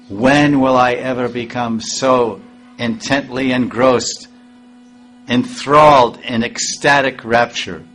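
rapturous reading. Acted. Reading rapturous translation aloud.

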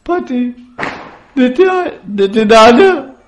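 very high, loud crying-wife voice; mock wailing. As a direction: wail hammily to the husband in falsetto. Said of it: "she immediately broke down crying".

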